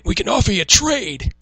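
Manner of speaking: loud, high caricatured salesman offer; John Laurinaitis. Acted. Salesperson offering a trade instead of cash, mocking impression. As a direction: sell it big in the put-on voice. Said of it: "let me get back to the voice".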